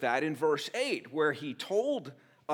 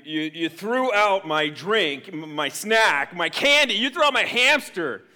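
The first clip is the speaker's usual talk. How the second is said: building outrage; 2 semitones higher, 9 dB louder than usual. Acted. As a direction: pile on the grievances, louder each time. Escalating accusations against dad.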